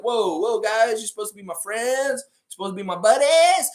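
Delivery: high-pitched alarmed mascot voice, rising to a cry; hammed-up high whine of betrayal; pleading Raptor. Raptor pleads with attacking wolves.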